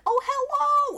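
put-on very high, loud hello to a small child; hammed-up delight at a little visitor; gushing greeting. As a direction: coo brightly like an adult greeting a toddler. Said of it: "we're all like".

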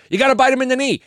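a high, loud, fired-up coach quote. Retold or imitated. Coach urging his team to bite opponents, loud and high. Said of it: "and Dan Campbell said".

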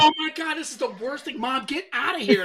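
high-pitched mock teen outrage; hammed-up teenage whine; exasperated teenager. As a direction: imitate an embarrassed teen complaining about Mom. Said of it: "And she's a teenager, like".

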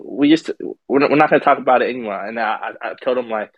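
ordinary talk

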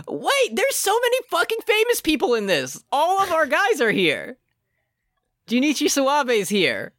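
high-pitched astonished exclaiming; shocked disbelief. Real, not acted. Host finds famous actors in the cast list, high-pitched disbelief. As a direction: exclaim each find in high, incredulous amazement.

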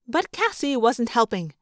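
plain reading voice

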